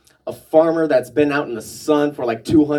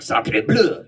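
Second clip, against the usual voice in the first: French exclamation; 3 semitones higher than usual. Retold or imitated. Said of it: "you gotta speak in French".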